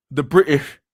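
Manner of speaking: high, loud mock British accent; bad British accent. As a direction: try a hammed-up British accent. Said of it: "I can't speak British".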